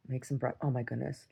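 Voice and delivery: usual speaking voice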